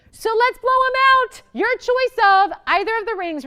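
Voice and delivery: big hype. Real, not acted. Shopping host kicking off the blowout for viewers, hyped. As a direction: launch the blowout like a game show host.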